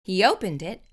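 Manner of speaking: ordinary delivery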